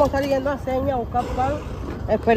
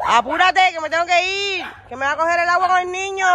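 higher and louder, calling out urgently; 8 semitones higher, 4 dB louder than usual. Real, not acted. Mother calls out so the rain won't catch her child, urgent shout. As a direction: hurry someone along before the rain.

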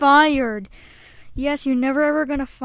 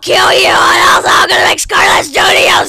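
furious shout; 4 semitones higher, 12 dB louder than usual. Acted. Furious threats at the toys.

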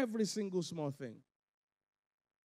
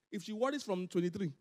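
normal talking voice

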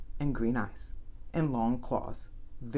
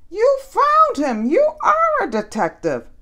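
much higher and louder, thrilled; overjoyed; 11 dB louder than usual. A narrator's reading. Rosamond praises the detective, high thrilled gush. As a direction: gush with delight, slowly.

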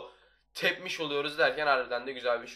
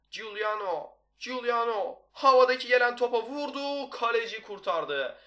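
rising commentary call; 8 semitones higher, 3 dB louder, slower than usual. Real, not acted. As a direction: pitch climbing on the name.

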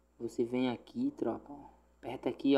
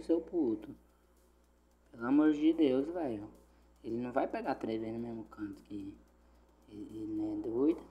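ordinary delivery

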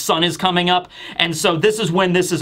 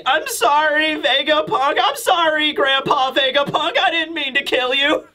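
put-on much higher, teary little-girl apology; imitating weepy Bonnie; 9 semitones higher than usual. Girl hugging her grandpa figure, apologizing in a high teary voice. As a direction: sniffle out the apology like a small child. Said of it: "she's all still crying and weepy".

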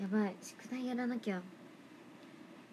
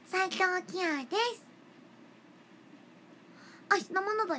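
impression; 7 semitones higher than usual. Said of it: "モノマネをしましょう".

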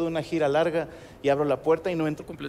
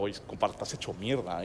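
lower, much softer gruff man voice; imitating Alfredo; 8 semitones lower, 11 dB quieter than usual. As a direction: grumble it like a blunt friend. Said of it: "el vato". (imitando a Alfredo con voz grave y baja)

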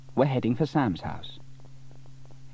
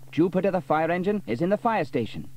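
joking Sam; 8 semitones higher, 5 dB louder than usual. A narrator's reading. Sam makes joke.

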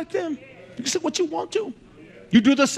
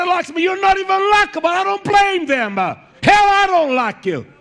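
raised, higher-pitched preaching outburst; heated rebuke; 6 semitones higher, 4 dB louder than usual. Real, not acted. Preacher rebuking listeners who blame others, heated. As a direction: throw the blame back hard, loud and high.

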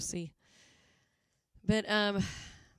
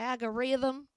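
"Algorithm" in a southern accent, higher and rising; 4 semitones higher, 3 dB louder, slower than usual. Real, not acted. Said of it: "I got my southern accent going on".

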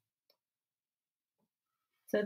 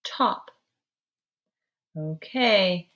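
ordinary talk

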